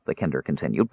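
plain reading voice

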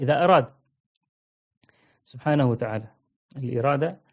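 ordinary talk

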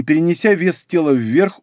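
usual speaking voice

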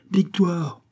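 high-pitched mock cry of triumph. A narrator's reading. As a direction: call out the news, voice lifted. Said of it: "S'est-il écrié".

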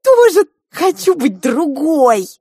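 put-on high, loud, drawn-out child's wail; envious scream. A narrator's reading. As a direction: wail it out slowly at full voice. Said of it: "она закричала".

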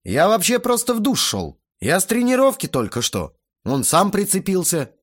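high-pitched excuses; defensive protest. A narrator's reading. Teenager blames the guest to his father, defensive. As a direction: plead innocence indignantly.

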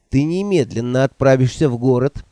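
ordinary delivery

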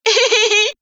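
put-on very high childish giggle; little boy's giggle. A narrator's reading. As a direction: giggle like a delighted small kid. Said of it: "рассмеялся Санька". (тоненькое детское хихиканье)

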